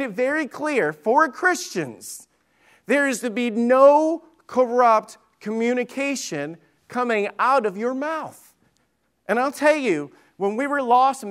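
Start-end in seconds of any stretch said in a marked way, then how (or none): 2.9-8.5 NO CORRUPT COMMUNICATION spaced out slowly, high and loud; emphatic preaching. Acted. Preacher laying down scripture to Christians, emphatic. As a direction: drive home each phrase of "out of your mouth" from a high pitch. Preacher drives home the verse.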